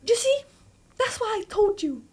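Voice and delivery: high-pitched mom impression; imitated scolding mom. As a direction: scold in a high told-you-so voice. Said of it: "So my mom would be like".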